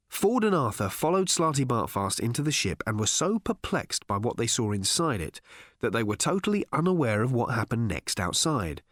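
plain reading voice

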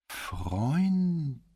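high, dragged-out questioning drawl; sluggish drawl, wry. A narrator's reading. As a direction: stretch the word with a crooked smile. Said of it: "mit einem schiefen Lächeln".

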